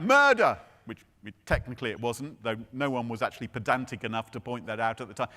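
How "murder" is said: loud, high cry of alarm; shouted cry. Retold or imitated. Retelling the keeper shouting out on regaining consciousness, shouted cry. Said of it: "began to shout out".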